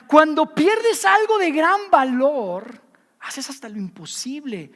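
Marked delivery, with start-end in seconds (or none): 0.1-2.8 slow, louder and higher insistence; forceful emphasis. Acted. Preacher repeats his key line to the congregation, slow and loud. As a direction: drive the point home with force.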